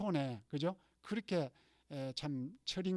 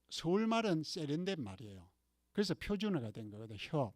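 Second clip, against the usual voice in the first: usual speaking voice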